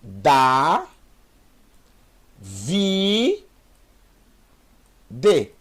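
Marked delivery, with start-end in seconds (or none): 0.0-3.4 Da and Vi said as separate syllables. Shown as a demonstration. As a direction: spell out Da, Vi slowly. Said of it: "Então eu tenho". (sílabas separadas: Da, Vi)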